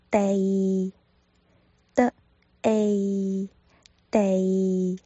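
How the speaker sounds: 地 spelled out via 的 and a; Cantonese dei. Shown as a demonstration. Teacher spelling out 地 for learners, sounded out. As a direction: sound out 地 piece by piece. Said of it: "结尾落在一上".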